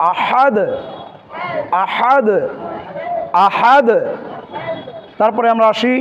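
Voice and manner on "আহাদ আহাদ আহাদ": আহাদ with the h pressed from mid-throat; careful throat ha. Shown as a demonstration. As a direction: articulate আহাদ carefully, squeezed h, echoed d. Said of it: "একটু সতর্কতার সহিত উচ্চারণ করতে হবে". (আহাদ-এর হা গলার মাঝখান থেকে চেপে)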